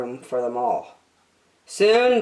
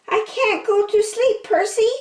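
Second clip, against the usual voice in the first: very high, small squirrel voice; high little critter pleading for a bed. A narrator's reading. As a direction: squeak it out like a tiny cold squirrel. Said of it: "said the squirrel".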